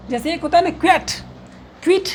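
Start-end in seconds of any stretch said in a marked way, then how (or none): 1.8-2.1 क्विट with a short i, "kvit"; wrong pronunciation. Shown as a demonstration. Teacher shows students what not to say, wrong pronunciation. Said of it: "नहीं बोलेंगे".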